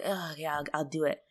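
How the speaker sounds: soft reluctant agreement; imitated grudging surrender; mock giving in. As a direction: concede quietly, dragging your feet. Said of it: "he gives in".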